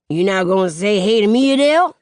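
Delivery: loud, slurred together, rising at the end; jumbled words. A narrator's reading. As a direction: run it into one long word, calling out. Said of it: "it jumbled together like one long word".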